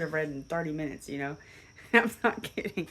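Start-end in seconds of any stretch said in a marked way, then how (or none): 1.7-2.9 laughing. Real, not acted. Laughing at own story.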